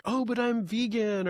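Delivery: high, drawn-out mock objection; imitating someone clinging to an identity; mock protest. Voicing a vegan's identity crisis.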